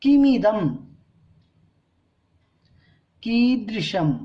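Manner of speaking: किमिदम, कीदृशम each said carefully, with long pauses; careful recitation. Shown as a demonstration. Teacher teaching correct pronunciation, careful recitation. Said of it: "अपन शुद्ध उच्चारण करना सीखते हैं".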